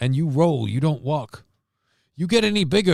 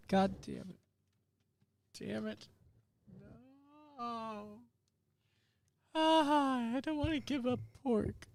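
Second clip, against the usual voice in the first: mock despair; 10 semitones higher, 12 dB quieter than usual. Real, not acted. Realizes gravy has pork.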